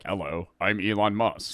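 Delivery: lightly mocked robotic AI voice; a flat chatbot-style mock greeting; Elon Musk AI voice. Streamer mocking the fake AI voice for chat, lightly robotic. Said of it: "Elon Musk A I, like, Chat G P T voice".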